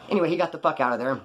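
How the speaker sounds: normal talking voice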